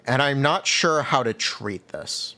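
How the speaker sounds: usual speaking voice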